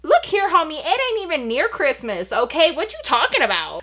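high, sassy street-talk comeback; sassy mock retort. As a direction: put her in her place with attitude.

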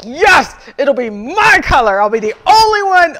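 high, loud delighted squeal; gleeful, claiming it as hers; excited. Retold or imitated. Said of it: "'Cause I'm like".

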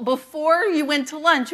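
ordinary talk, nothing marked

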